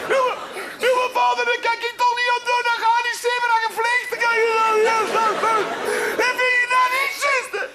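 veel hoger, snel, huilend roepen; huilend kind. Acted. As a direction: jammer het uit als een kind in tranen. Kind huilt tegen vader. (kindje huilt en roept tegen vader)

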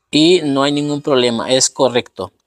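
ordinary talk

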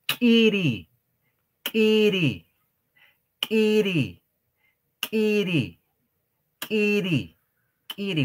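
"Kiri" dicho alto, con la K glotalizada que revienta; glottal K burst. Shown as a demonstration. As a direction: hacer explotar la K de "Kiri", arriba y fuerte. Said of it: "se tiene que pronunciar como si estaríamos haciendo reventar o explotar". ("Kiri" con k glotal explosiva, tono alto)

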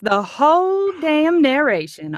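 slow Southern drawl; Georgia accent. Shown as a demonstration. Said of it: "read exactly like this".

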